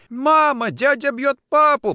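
put-on very high, loud child's wail; crying child. A narrator's reading. Child watching father beaten, crying child. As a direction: wail for mother like a frightened child. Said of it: "плакал ребёнок".

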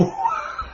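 high, pained burst of laughter. Real, not acted. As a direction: laugh out of sorrow, not scorn. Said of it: "Tôi cười mà các vị thấy tôi cười cái giọng khác".

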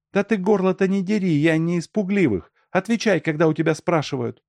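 high-pitched woman snapping back; high, quick, scolding retort; defiant quarrel. A narrator's reading. Woman quarrels back, refusing to be scared. (сварливо, высоким голосом)

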